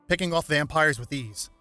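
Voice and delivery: usual speaking voice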